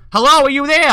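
high, loud call into a dark house. Retold or imitated.

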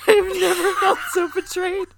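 hammed-up wounded wail, half laughing; scolded in her own home, mock-crying; mock betrayal. Mock betrayal after being scolded.

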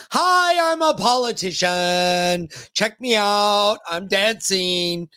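hammed-up, drawn-out politician voice; slow showy mock of a politician. Host mimics a politician he distrusts, hammed-up and drawn out. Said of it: "And then she said".

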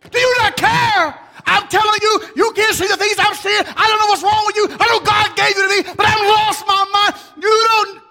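high, loud, frantic ranting; hammed-up husband losing it, shouting high; mock furious shout. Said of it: "I got so mad".

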